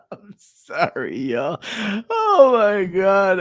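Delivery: real helpless laughter through the words; laughing so hard it sounds like crying; cracking up. Real, not acted. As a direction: apologize while still laughing, slowly. Cracking up after the clip.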